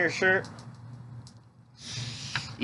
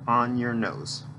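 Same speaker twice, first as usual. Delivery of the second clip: normal talking voice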